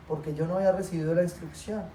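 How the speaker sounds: ordinary talk, nothing marked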